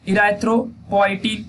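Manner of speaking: erythropoietin stretched out, syllable by syllable; teacher dictating a hormone name slowly; slow term for notes. Shown as a demonstration. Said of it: "वहां slow बोलना चाहिए".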